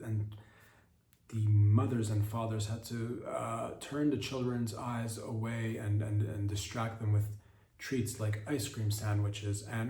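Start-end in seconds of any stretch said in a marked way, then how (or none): none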